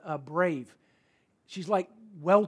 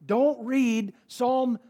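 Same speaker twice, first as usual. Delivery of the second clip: "Don't" drawn out, higher and louder; slow emphatic; 4 semitones higher, 3 dB louder, much slower than usual. Shown as a demonstration. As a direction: stretch "Don't read Psalm" slowly.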